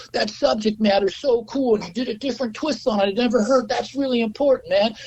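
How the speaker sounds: high, eager schmoozer voice; gushing record guy. Retold or imitated. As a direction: gush at him like a slick industry guy. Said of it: "He goes".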